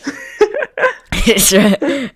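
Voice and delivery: breaking into high laughter mid-word; laughing. Real, not acted. Reader cracking up over the line, laughing. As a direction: start reading and lose it to laughter. Laughing while trying to read on.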